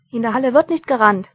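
loud rebuke across the hall; scolding call. A narrator's reading. Dwarf scolding Mumm for running, loudly. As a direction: tell him off from afar.

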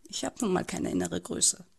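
usual speaking voice

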